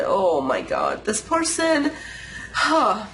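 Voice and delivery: ordinary talk, nothing marked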